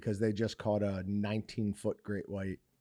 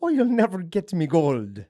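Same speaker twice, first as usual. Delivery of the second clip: higher, hammed-up Irish leprechaun; Irish accent; 5 semitones higher, 6 dB louder than usual. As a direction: play up a cartoon Irish lilt. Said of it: "do a super Irish accent".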